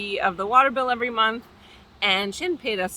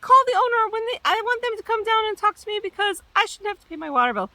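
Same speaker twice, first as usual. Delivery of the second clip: high, louder indignant mock whine; mimicking a furious tenant, pitched way up; mad tenant; 12 semitones higher, 3 dB louder than usual. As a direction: squeal it out like an outraged tenant. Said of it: "And she's like".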